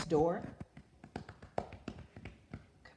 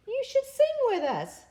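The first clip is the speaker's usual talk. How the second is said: high, loud chirpy invitation; sing-song call from the birds; birds' voice; 7 dB louder than usual. A narrator's reading.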